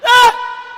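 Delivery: high, very loud sudden scream; imitated holler. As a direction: mimic your brother hollering at full volume. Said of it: "he hollered all the motherfucking time".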